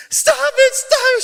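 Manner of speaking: imitated mom's shriek, very high and loud; hysterical yelling. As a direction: screech it like a frantic mother. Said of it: "she's yelling".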